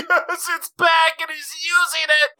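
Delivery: high, gleeful burst of joy; excited. Retold or imitated. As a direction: squeal it like a happy fan. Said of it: "I was like".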